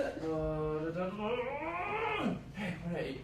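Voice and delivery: a hint of giddy, out-of-control scatting; bursting into excitement. Shown as a demonstration. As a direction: scat it like joy is spilling over. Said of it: "or you're like".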